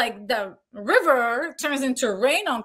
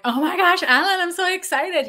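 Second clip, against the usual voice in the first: higher and louder, starstruck; excited gush; 8 semitones higher, 5 dB louder than usual. Retold or imitated. Foley artist meeting famous supervisor Alan, excited gush. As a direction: gush like meeting your hero. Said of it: "I'm like".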